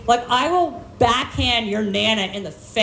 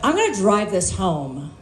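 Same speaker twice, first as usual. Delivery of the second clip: normal talking voice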